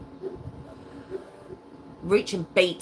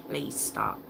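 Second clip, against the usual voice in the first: quiet begging; 7 semitones lower, 6 dB quieter than usual. Retold or imitated. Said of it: "begging them and saying".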